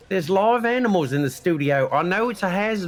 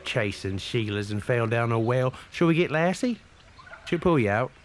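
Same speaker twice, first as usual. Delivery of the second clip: put-on Australian accent; 3 dB quieter than usual. Put-on Aussie accent throughout.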